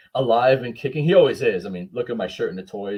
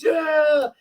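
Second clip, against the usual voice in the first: crowd cheer; 6 dB louder than usual. Retold or imitated.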